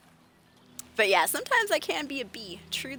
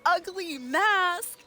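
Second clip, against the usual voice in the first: very slow, rising "Ugly mask?", pitched high; disbelief; 8 semitones higher, 3 dB louder, much slower than usual. Retold or imitated. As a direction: drag it out, stunned. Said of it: "and I was like".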